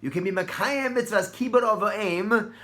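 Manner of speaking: hammed-up pleading mother; urgent mother. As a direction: push him like an insistent mother. Rivka urges Yaakov to steal blessing.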